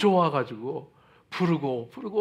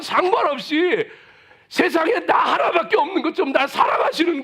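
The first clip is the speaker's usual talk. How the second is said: higher and louder, crying out with tearful intensity; fervent preaching; 8 semitones higher, 5 dB louder than usual. Acted. Preacher proclaiming God's love to the congregation, fervent tearful shout. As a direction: proclaim God's love at the top of your voice, near tears.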